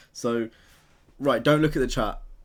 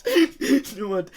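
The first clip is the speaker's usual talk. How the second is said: high squealing laugh through the words; 8 dB louder, much slower than usual. Real, not acted. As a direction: laugh it out in protest. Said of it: "laughing protest".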